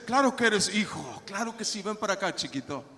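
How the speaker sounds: softening, dropping low toward the end; fatherly reassurance. Acted. Holy Spirit reassuring a nervous believer, tender fatherly comfort. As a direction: soothe a nervous child with growing tenderness. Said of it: "El Espíritu Santo viene y dice".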